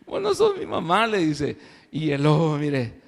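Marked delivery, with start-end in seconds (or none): 0.1-1.0 put-on very high, tiny kid voice; little goats scolding. Little goats telling the wolf he is not their mother. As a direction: squeak it like defiant little goats.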